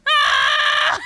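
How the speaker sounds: very high, loud mock wail; hammed-up scream-crying; sobbing wail. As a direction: wail like an overblown breakdown. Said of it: "like, sobbing".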